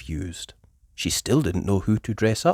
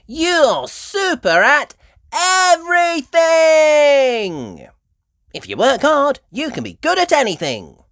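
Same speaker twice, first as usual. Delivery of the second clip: Miss Rabbit; 9 dB louder than usual. A narrator's reading. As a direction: cheer Peppa on.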